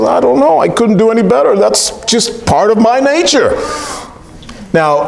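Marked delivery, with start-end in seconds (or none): 0.0-3.6 shrugging scorpion. Retold or imitated. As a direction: play the scorpion owning its nature. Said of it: "Scorpion says".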